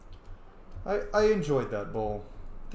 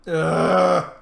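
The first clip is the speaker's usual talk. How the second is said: loud crying groan, pitched up; 3 semitones higher, 9 dB louder than usual. Real, not acted. Streamer groaning at the next conversation: loud groan. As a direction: let out a loud pained groan. Reacting to game scene.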